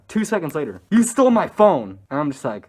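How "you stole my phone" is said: loud, high mock outburst; sudden reenacted outrage; retold accusing shout. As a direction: burst out accusing, as an imitation.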